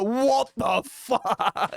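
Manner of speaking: very slow, drawn-out shocked dad; dad's stunned reaction, slow and stretched. Retold or imitated. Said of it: "the dad's just like".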